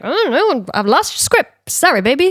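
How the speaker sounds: imitating a breezy, insincere brush-off; mock dismissive. Artist fobbing off the writer about her lost script, mock dismissive.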